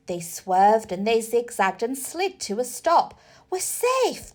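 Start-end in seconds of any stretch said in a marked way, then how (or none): 3.5-4.3 high, relieved burst; relieved exclamation. A narrator's reading. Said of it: "George exclaimed in relief".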